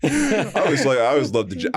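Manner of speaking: ordinary talk, nothing marked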